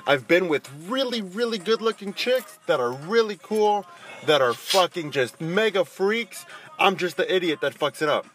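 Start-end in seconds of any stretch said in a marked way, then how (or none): none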